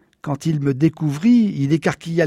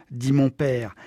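usual speaking voice

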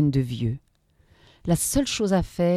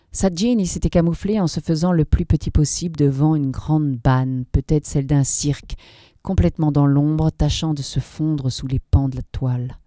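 usual speaking voice

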